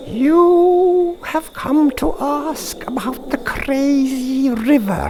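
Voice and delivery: very high, slow witch voice; knowing witch who already knows why he came; wise old witch. Acted. The witch greets Thorpe.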